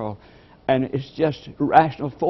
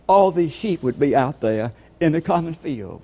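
ordinary delivery

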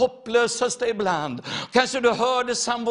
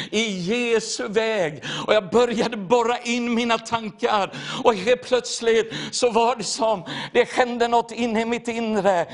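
ordinary delivery

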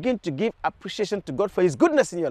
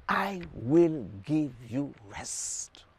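slow quoted promise; 5 semitones lower, 6 dB quieter, much slower than usual. Acted. Preacher quoting Jesus to the congregation, slow and deliberate. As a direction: draw out every word, very slowly.

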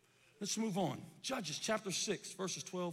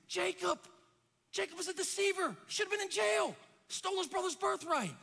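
high, insistent sermon cry; full-force preaching; 10 semitones higher than usual. Acted. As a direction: call out the sinner's case to the congregation.

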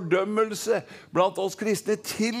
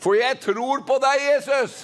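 raised-pitch confession for the crowd to echo; ringing declaration of faith; leading congregation; 5 semitones higher than usual. Shown as a demonstration. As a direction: proclaim it high, as a call-and-response lead. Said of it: "Kan vi si det?".

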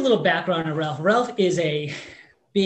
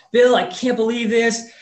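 a louder, higher burst of disbelieving joy; excited; 5 semitones higher, 6 dB louder than usual. Retold or imitated. Ralph hearing he's pre-qualified, speaking to Bill, excited and loud. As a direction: let the excitement spill over, loud and lifted. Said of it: "He's so excited".

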